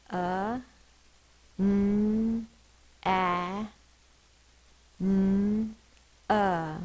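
"Uh, n-, a, n-, uh" held singly with long pauses; sounded out. Shown as a demonstration. Teacher finishing banana sound by sound, "Uh, n-, a, n-, uh" drawn out. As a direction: hum each n long in "Uh, n-, a, n-, uh". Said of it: "Sound it out".

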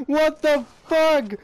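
slow, drawn-out high yell; long loud disbelieving wail; stunned yell. Real, not acted.

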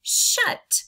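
high, loud breathy "Shut"; "Shut" announced brightly; the answer word "Shut"; whole word. Shown as a demonstration. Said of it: "What's our word".

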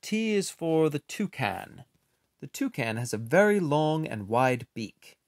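ordinary delivery, nothing marked

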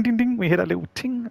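high ringing ding ding, little ting; imitated ding. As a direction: chime it out like a pan lid. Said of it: "The lid sound sounds good".

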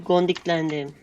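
ordinary delivery

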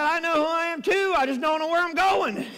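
hammed-up exasperation for the punch line; exasperated Einstein. Lost Einstein answering the train conductor, comically exasperated.

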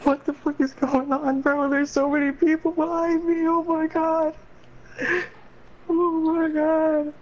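high, excited gamer yelling. Real, not acted. Chaos of people chasing him.

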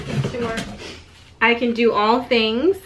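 ordinary delivery

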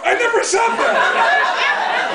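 high, slow, drawn-out laughing yell; a hammed-up indignant cry, half laughing; mock shout. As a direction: stretch it out up high, cracking up as you protest. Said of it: "I shout".

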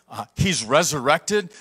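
ordinary talk

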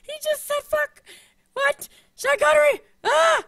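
excited fangirl shriek. Retold or imitated. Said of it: "I'm just sitting here like".

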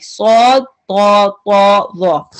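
सॉ, तौ, पौ, वौ each said thick and full-mouthed, tongue spread to the palate; सॉ तौ पौ वौ sounded heavy, each letter alone. Shown as a demonstration. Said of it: "इनको full पढ़ना होता है".

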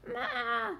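high, drawn-out "Ma"; sheep bleat. A narrator's reading. Sheep answering from the next box, bleating.

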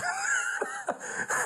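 a high burst of laughter. Real, not acted. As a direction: laugh at the memory.

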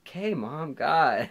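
put-on embarrassed teenager brushing off his mother; annoyed teen. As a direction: roll your eyes at mom. Annoyed teen answering his mom.

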